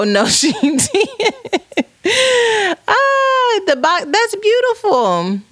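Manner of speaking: high, stretched-out words breaking into laughter; laugh, then coo in a high, drawn-out voice; delighted laughing. Real, not acted. Host delighted by a parent's story, laughing and high-pitched.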